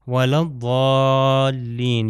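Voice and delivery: الضالین with a long, heavy ض, chanted slowly; slow chant with the ض of الضالین held heavy; recited dhaad. Shown as a demonstration. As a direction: draw out الضالین with a full ض. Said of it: "یہ ضاد ہے".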